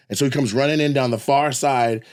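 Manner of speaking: usual speaking voice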